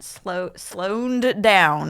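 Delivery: slow it right down like a drawl; slowed-down Southern drawl; drawn out slow. Shown as a demonstration. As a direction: stretch every word, very slow. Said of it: "the Southern accent is a British accent".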